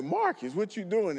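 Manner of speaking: half-joking shout-out from the bleachers; retelling hollering at the referee; mock call from the stands. Retold calling to referee in stands.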